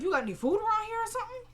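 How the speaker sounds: high, fast retold question; imitated woman. Woman asking her captive for food, high-voiced imitation. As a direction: put on a quick high voice, lifting at the end.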